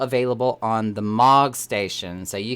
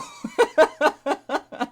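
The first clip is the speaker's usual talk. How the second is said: high, surprised laugh; burst of laughter. Real, not acted. Laughing at the reveal.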